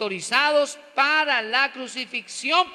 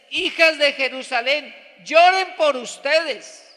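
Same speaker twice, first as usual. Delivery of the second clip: loud, raised, drawn-out commands; impassioned exhortation; 4 semitones higher, 7 dB louder, slower than usual. Acted. Jesus answering the women's lament, exhorting loudly. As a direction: cry it out slowly to the women.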